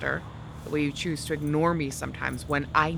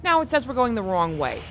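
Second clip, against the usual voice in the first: usual speaking voice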